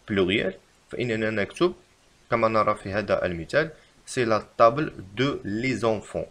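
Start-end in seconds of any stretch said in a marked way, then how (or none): 4.1-6.3 slow, careful wrong example; slow dictation. Shown as a demonstration. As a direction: dictate it slowly. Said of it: "فالخطأ في هذه الجملة".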